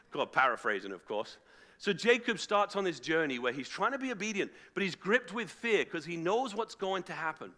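ordinary delivery

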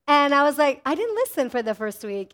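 normal talking voice

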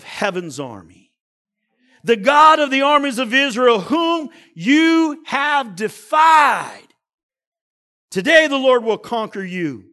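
loud, high declaration with stretched key words; fired-up preaching. A narrator's reading. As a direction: proclaim it like a preacher lighting it up. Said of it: "this guy is preaching".

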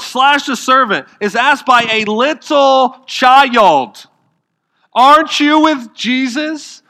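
high, loud driving sermon voice; forceful preaching. Acted. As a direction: preach it hard to the crowd.

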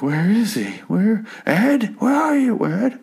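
high, hammed-up calling voice; frantic searching. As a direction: call out for a lost friend in mock desperation. Retells frantic man searching the bar.

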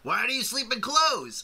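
high, drawn-out mock demand; mock incredulous question. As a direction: ask it slowly in a high, put-on voice. Acting out film character's challenge.

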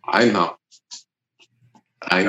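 put-on brief, even reply in a Russian accent. Cosmonaut answers his dying crewmate, Russian accent. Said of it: "I'm gonna make it Russian".

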